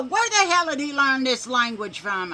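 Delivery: grandma outraged at the cussing; indignant grandma. Retold or imitated. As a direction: ask it like she's scandalized. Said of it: "She go".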